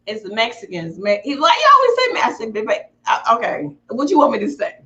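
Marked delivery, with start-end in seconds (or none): none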